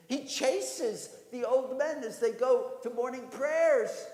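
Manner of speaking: loud, high-pitched outraged objection; alarmed protest. Acted. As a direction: cry out in alarm to warn the rabbi. Frightened villager protesting to rabbi.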